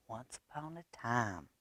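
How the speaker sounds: softly, as if from across the room; a far-off reading voice; distant storyteller. A narrator's reading. Distant storyteller heard in library.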